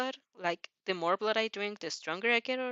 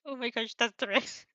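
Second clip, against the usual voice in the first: ordinary delivery, nothing marked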